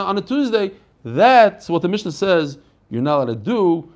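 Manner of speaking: normal talking voice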